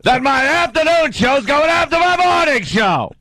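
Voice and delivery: mock the boss to the stockholders; Mel Karmazin impression. Boss complaining about his shows, lightly voiced. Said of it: "That sounded like Mel Karmazin".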